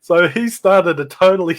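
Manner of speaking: ordinary delivery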